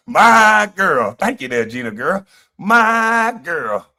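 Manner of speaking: mock-happy, high calls on "my girl"; Marty Marr. Streamer likening happy TJ to a comedian, high mock delight. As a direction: imitate a delighted man, high on the catchphrase. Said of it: "He was sounding like Marty Marr".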